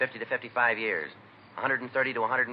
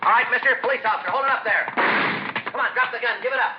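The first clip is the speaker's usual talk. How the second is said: loud, high commanding shout; shouting at the bandit; 6 dB louder than usual. Acted. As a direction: bark the order to drop the gun. Cop confronting armed bandit.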